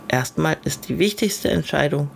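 usual speaking voice

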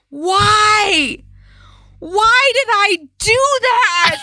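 high, slow mock wail of a sobbing boy; imitating wailing child. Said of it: "I hear him going".

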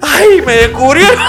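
laughing caught out. Retold or imitated.

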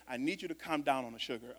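hushed divine warning; quoted God, low and soft. Retold or imitated. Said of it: "I heard God speak to me and say".

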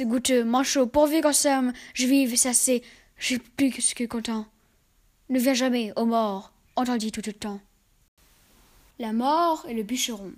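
ordinary delivery

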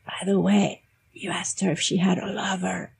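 hushed, whispered aside in a retold quote; confidential whisper. Teacher quietly tells her student what he really asked, confidential whisper. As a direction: lean in and whisper it discreetly. Telling the student privately in English.

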